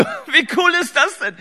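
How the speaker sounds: high, laughing, slow delight; amused proud dad cracking up. Real, not acted. Delighted at son's curse.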